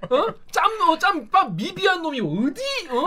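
high-pitched Korean dialect, indignant put-on; hammed-up Korean dialect put-down; mock dialect scolding. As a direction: play a scornful senior in regional dialect. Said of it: "사투리만 이렇게 쓰면 안 돼요".

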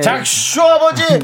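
high, drawn-out playful call; mock announcement. Co-host hails the rapper as a new father, playful high call. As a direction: call out the nickname with playful fanfare. Playfully calling out guest's nickname.

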